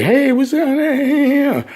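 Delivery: squeaky high friendly hello; lightly voiced cheerful greeting; high mock greeting. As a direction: chirp it up high and slow. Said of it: "Used to say".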